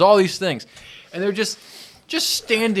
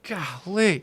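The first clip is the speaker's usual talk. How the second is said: normal talking voice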